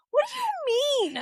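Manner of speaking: high squeaky what do you mean; mock indignant. Angry friend questioning the outfit, high mock indignation. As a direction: squeal it in fake shock. Retelling her angry reaction.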